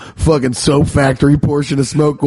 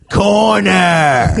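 sharp, high shout; barked order; 7 semitones higher than usual. Retold or imitated. Scary teacher sending a student away, shouting. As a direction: bark the order at the kid. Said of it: "And he'd be like".

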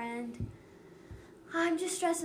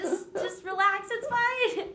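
slow, higher mock-calming voice; lightly imitated self-reassurance; mock soothing; 7 semitones higher, 4 dB louder, slower than usual. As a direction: pretend to calm yourself down, slowly. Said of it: "I was like".

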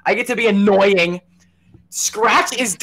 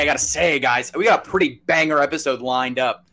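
deep, soft host voice; low announcer voice; 6 semitones lower, 4 dB quieter than usual. Acted. Host intro redone in low voice.

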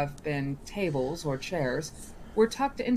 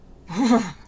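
light laugh; 5 semitones higher, 8 dB louder than usual. Acted. Genie laughs at the cold.

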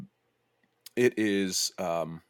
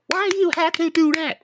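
much higher, louder exaggerated whine; mocking girlfriend voice; 8 dB louder than usual. Girlfriend scolding the narrator for insulting her man, hammed-up high whine. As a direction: ham up an overly enunciating girlfriend. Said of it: "and she's enunciating".

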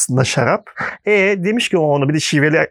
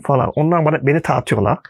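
ordinary talk

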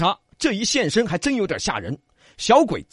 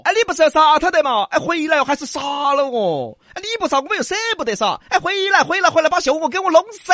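high, fast, worked-up plea; frantic calling back; 9 semitones higher, 4 dB louder than usual. A narrator's reading. Frustrated ghost calls the killer back, frantic. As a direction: call after him in high agitation.

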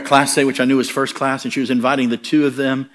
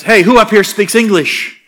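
calling out; 8 semitones higher, 7 dB louder than usual. Retold or imitated. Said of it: "all of a sudden he goes".

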